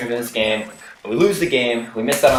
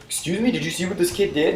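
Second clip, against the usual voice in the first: much higher-pitched affronted complaint; mock indignant parent; 8 semitones higher, 4 dB quieter than usual. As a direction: imitate a parent reporting a kid to the teacher.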